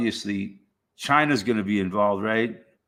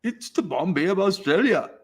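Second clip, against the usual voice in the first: high, put-on Indian accent; hammed-up Indian accent for a joke; 9 semitones higher than usual. Shown as a demonstration. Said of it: "say it with an Indian accent".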